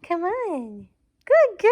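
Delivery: very high, loud excited praise; dog voice. Real, not acted. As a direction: cheer the puppy on up high. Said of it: "my dog voice".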